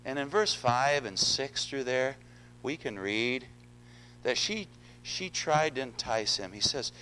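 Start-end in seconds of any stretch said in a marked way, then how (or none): none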